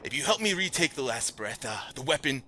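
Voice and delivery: urgent command. Acted. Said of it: "he's like".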